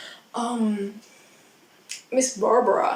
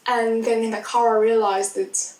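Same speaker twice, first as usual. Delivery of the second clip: usual speaking voice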